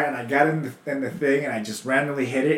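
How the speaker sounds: ordinary talk, nothing marked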